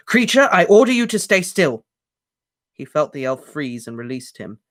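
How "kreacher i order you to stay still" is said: high, loud bellowed order. A narrator's reading. Harry bellows an order to pin the elf. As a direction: bellow to freeze him. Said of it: "Harry bellowed louder than both".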